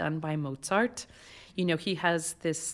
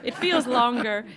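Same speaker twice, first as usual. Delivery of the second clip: higher and louder, laughing through the words; laughing relief; 5 semitones higher, 7 dB louder than usual. Real, not acted. Laughing relief about short intro.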